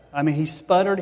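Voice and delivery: ordinary delivery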